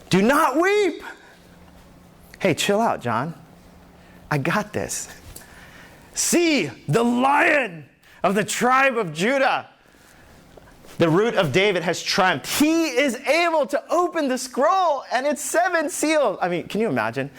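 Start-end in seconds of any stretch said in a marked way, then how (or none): 0.1-1.0 urgent call. Acted. Elder calling out to John. 6.1-16.4 high, ringing herald of victory; triumphant announcement. Acted. Elder proclaiming the lion to weeping John, triumphant.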